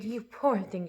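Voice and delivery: soft, quiet sympathy; softly murmured pity. Acted. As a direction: murmur it gently. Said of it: "she murmured".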